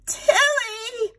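high, loud cry of the bird's name; Aunt Sarah crying out to her bird; cried out. Acted. Aunt Sarah cries out to Tilly at the window, high loud cry. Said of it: "cried Aunt Sarah".

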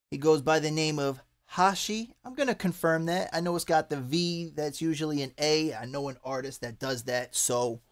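usual speaking voice

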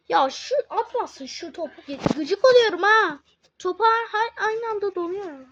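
high, loud exasperated rant; real irritation bursting out; frustrated outburst. Real, not acted. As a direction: vent at the cannon. Annoyed at enemy cannon.

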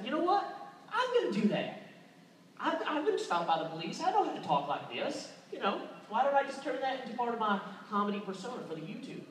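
thick country accent played for laughs; Southern comedy voice. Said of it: "really don't like this voice".

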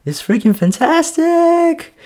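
voicing his own amazed thought; mock gushing thought. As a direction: pitch jumps high on the last words. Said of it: "It's like".

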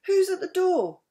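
sweet voice calling. A narrator's reading. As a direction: sing out sweetly to whoever is outside. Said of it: "a sweet voice called from inside".

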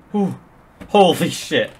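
loud, stunned exclamation; breathless relief. Real, not acted. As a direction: blurt it out in disbelief. Relief after close finish.

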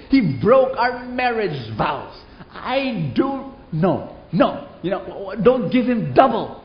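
a wife venting fury at the genie; angry wife. Acted. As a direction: spit out the hatred of the cheating husband.

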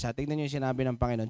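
usual speaking voice

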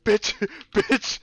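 loud, high yelp into laughter; laughing yell. Real, not acted. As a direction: yell then crack up. Scared, yelling and laughing.

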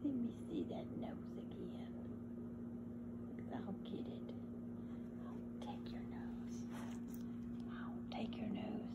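soft whisper to the dog; whispered sympathy to the dog. Real, not acted. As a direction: hush and coo to a hungry pup.

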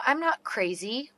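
usual speaking voice

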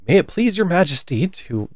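high-pitched, deferential subject; meek, placating voice; very humble. A narrator's reading. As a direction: plead humbly to royalty. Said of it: "said Two very humbly".

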